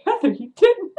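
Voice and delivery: high, loud, scandalised; shocked disbelief. Retold or imitated. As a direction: imitate an appalled husband. Acts out husband's shocked reaction.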